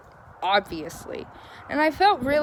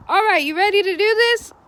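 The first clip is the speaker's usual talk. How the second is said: loud, higher-pitched mock hearty greeting; imitating upbeat mechanic; 10 semitones higher, 8 dB louder than usual. Mechanic greeting a customer at the shop, imitated loud and peppy.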